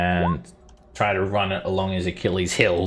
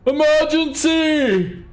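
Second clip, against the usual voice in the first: high, slow, panicked cry of a fleeing townsman; frantic alarm call; panicked shout; 6 dB louder, much slower than usual. Acted. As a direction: yell the alarm, stretched out and high.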